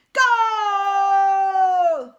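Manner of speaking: long, loud, high drawn-out cry; stretched-out victory yell; triumphant shout. A narrator's reading. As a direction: roar it out in triumph. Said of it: "shouted Bulk triumphantly".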